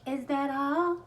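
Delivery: high, loud rising question; put-on sing-out query; mock cashier. Acts the cashier again.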